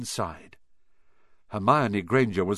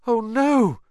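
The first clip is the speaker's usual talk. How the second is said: high, louder "Oh, no!" of dread; dismayed cry; 10 semitones higher, 6 dB louder than usual. Acted. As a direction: realise the horror all at once. Ron hears the scream.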